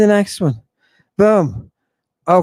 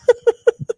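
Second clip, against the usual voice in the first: short squeaky laugh, much higher; high giggle. Real, not acted. Lecturer laughing at the dead witness, high giggle.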